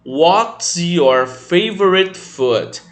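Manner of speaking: very slow, clear question; slow model question. Shown as a demonstration. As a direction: ask it slowly for learners to copy. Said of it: "lição dezoito". (pergunta bem devagar)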